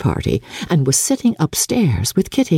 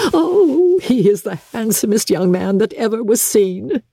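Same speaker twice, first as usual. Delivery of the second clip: high, gushing mother; an effusive, breathless mother; Mrs Bennet overjoyed; 5 semitones higher than usual. Acted. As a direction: overflow with delight at her daughter. Mrs Bennet gushes to Jane.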